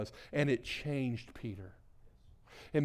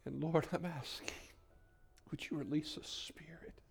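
soft, reverent praying with long pauses; quiet, pleading pastoral prayer; hushed prayer; 10 dB quieter than usual. Real, not acted. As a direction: speak intimately to God, nearly whispering at times. Leads the congregation in prayer.

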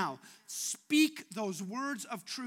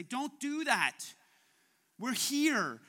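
urgent call; 4 semitones higher, 5 dB louder, slower than usual. Retold or imitated. Paul calling to the jailer.

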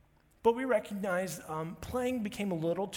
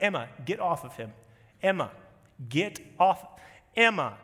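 firm parental call; 7 dB louder than usual. Retold or imitated. Parent ordering daughter off brother.

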